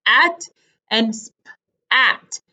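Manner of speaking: h...AT and SP...AT, each split by a pause; separated beginnings. Shown as a demonstration. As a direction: break the first sounds away from at. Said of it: "have different beginning sounds".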